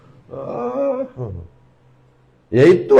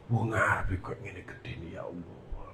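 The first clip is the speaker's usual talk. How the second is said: awed whisper; 5 semitones lower, 17 dB quieter than usual. Retold or imitated.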